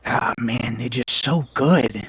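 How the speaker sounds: soft, admiring kobold murmur; kobold in awe. Acted. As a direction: marvel quietly, drawing the words out. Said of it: "you walk up and he says".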